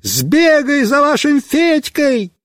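high, slow urgent yell; shouting. Acted. Boy yells to Mishka to fetch Fedka, shouting. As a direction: order him off with a shout. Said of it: "Я кричу этому дуралею".

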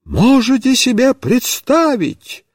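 high, slow, indignant exclaim. A narrator's reading. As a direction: cry out the news of the disaster. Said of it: "воскликнула Марья Петровна".

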